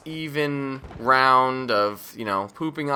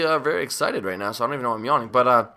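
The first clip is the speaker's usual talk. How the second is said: ordinary delivery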